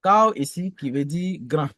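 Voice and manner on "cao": "Cao" held on one level note; level-tone demonstration of "Cao"; first tone. Shown as a demonstration. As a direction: say "Cao" as a steady, unbending tone. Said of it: "le ton constant, le premier ton".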